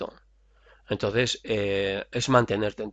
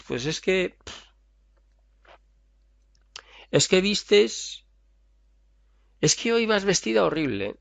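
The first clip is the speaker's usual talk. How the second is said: mock catty critic; 8 semitones higher than usual. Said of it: "Alguien te dice".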